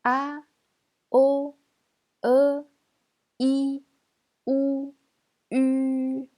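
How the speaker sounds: "A, O, E, I, O, U" each sustained high and level; loud, high, separate pure vowels "A, O, E, I, O, U"; chant-like level delivery of "A, O, E, I, O, U", pauses between; vowel drill. Shown as a demonstration. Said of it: "Je répète".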